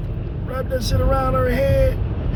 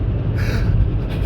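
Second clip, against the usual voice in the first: gasp. Retold or imitated. Fred asks what's going on.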